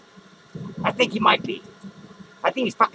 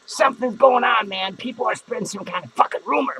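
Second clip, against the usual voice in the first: ordinary delivery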